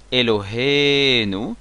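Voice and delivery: Eloheinu with a long, stressed he. Shown as a demonstration. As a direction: stretch the he in "Eloheinu". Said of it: "l'accent tonique".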